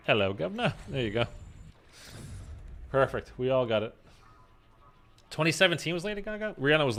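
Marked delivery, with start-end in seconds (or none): 0.1-0.7 higher, swooping cockney-style English greeting; playful put-on English accent; mock English accent. Shown as a demonstration. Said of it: "we don't speak like that".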